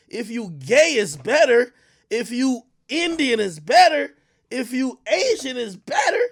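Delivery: loud, high, exasperated ranting; real heated tirade, punching the key words; worked-up rant. Real, not acted. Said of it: "Let me stop ranting over this shit".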